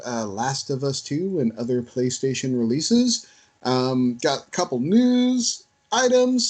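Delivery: upbeat podcast intro read for listeners; podcast host intro. Acted. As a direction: open the show like a radio host.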